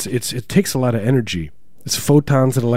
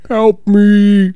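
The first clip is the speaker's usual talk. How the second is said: high-pitched drawn-out cry for help; faint eerie call; 11 semitones higher, 8 dB louder than usual. Acted. Voice in the radio static calling for help, high eerie wail. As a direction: call out high and long, like a spirit through static. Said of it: "like, faintly".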